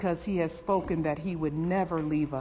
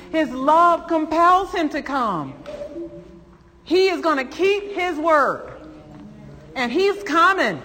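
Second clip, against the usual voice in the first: much higher, much louder, slow proclamation; preaching at full force; 11 semitones higher, 9 dB louder than usual. Acted. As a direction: thunder the promise over the church.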